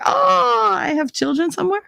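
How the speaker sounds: mock startled. Retelling own reaction to a sleepy-kid clip, mock startled. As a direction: squeal it high in comic realization.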